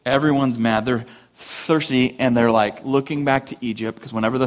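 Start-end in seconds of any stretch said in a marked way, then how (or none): none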